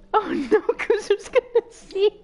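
high, slow laughing wail; half-cry, half-laugh in dismay; laughing panic. Real, not acted.

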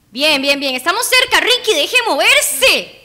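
loud, climbing high as it goes; excited urgent yelling. Real, not acted. Player telling Ricky they are close, urgent yell. Shouting at character near goal.

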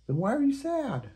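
high, soothing kitten; Kitty comforting Unicorn. Acted. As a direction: reassure a sad friend. Kitty character voice.

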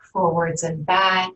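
usual speaking voice

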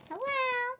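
high squeaky hello; helium voice. Real, not acted. Helium test.

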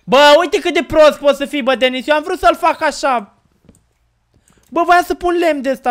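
loud, scolding his friend Denis; exasperated yelling. Real, not acted. Yelling at Denis for ruining the build, loud and exasperated. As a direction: yell it out, fed up with him.

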